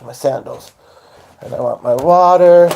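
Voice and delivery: usual speaking voice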